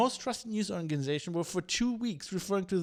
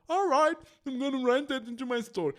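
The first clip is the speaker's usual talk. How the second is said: higher, louder dopey agreeable voice; mocking gullible journalist; 7 semitones higher, 5 dB louder than usual. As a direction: play the reporter who swallows everything. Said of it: "and you go".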